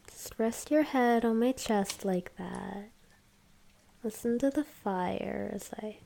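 sweet nothings. Acted. Cuddling afterwards, tender words.

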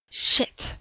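a sudden loud unvoiced curse; sharp curse. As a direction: spit the word out as the bag snags. Bag catches in the window.

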